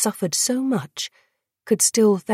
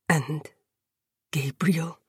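low, weak, hesitant question; whispered; 3 semitones lower, 6 dB quieter than usual. A narrator's reading. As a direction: ask it faintly, pausing before the name. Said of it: "he whispered".

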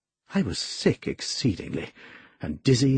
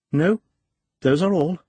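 lightly voiced woman, pitched high; raised-pitch woman's reply; voiced woman character; 7 semitones higher than usual. A narrator's reading. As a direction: answer in a higher female character voice. Narrator voices the woman answering him.

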